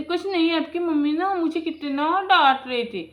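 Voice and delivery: high, loud tattling to the husband; whiny complaint. Acted. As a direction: play the wronged wife, complaining loudly.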